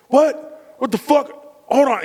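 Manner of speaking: dad caught off guard mid-sip, startled. Retold or imitated. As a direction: jump at the surprise. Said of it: "And he goes".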